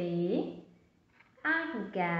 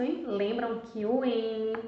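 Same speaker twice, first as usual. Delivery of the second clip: normal talking voice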